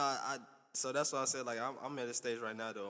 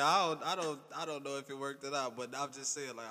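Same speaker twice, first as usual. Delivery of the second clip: ordinary delivery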